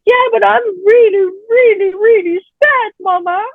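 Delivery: a high, lightly voiced child pleading; sad little boy. A four-year-old telling his mama he is sad, high and pleading. Son answers his mother.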